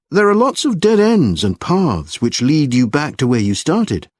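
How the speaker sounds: usual speaking voice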